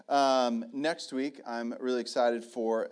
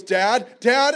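put-on loud, raised child's call; excited calling out; 8 semitones higher, 10 dB louder than usual. Second son calling his dad from the water, excited call. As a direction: call urgently for Dad like a kid.

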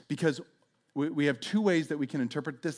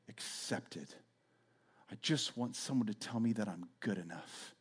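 quiet longing; 6 semitones lower, 10 dB quieter than usual. Retold or imitated. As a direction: confess an unmet longing. Voicing a longing soul.

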